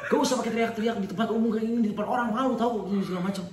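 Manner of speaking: high-pitched indignant mock rant; imitated scolding. Girlfriend scolds boyfriend for yelling in public, mock angry high voice. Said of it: "dia marah-marah".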